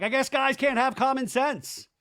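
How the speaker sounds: high, loud indignant retort; mock outburst. Recapper imitating Jason giving up on the fight, loud mock indignation. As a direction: throw it out like an exasperated parting shot.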